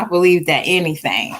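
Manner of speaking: ordinary talk, nothing marked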